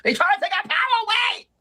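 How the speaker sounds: high, shouted mock outrage; comic rallying shout about men taking power. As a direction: yell it like a battle cry. Outburst mid advice.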